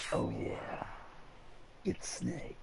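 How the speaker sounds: low gravelly whispered Snake voice; Snake impression. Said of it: "That's my best Snake impression".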